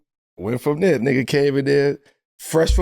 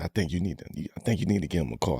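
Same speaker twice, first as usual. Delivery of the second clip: agent's voice, lightly done; 12 semitones lower, 11 dB quieter, faster than usual. Retold or imitated. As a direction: lower and softer, calm agent imitation. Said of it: "He said".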